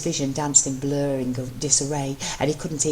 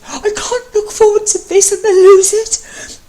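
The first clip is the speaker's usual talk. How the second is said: high, loud panicked cry; 13 dB louder, slower than usual. Acted. Matt panicking, crying out to his husband. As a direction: gasp it out in panic. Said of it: "gasped out in suffocating snatches".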